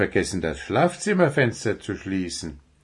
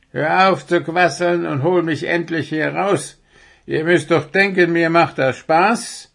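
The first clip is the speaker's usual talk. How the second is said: high, louder indignant complaint; fed-up owl demanding action, pitched up; complaining; 8 semitones higher, 4 dB louder than usual. A narrator's reading. Owl stuck in the window scolds the chatterers, complaining. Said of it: "beschwerte sich Willi".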